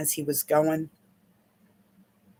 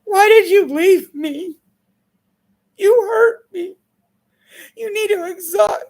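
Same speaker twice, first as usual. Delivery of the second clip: high, loud, voice breaking into a cry; anguished tearful plea; 12 semitones higher, 7 dB louder than usual. Pretend. Coaching a grieving client, voicing her cry to the ex. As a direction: plead with the ex as if it still hurts. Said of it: "And I was like".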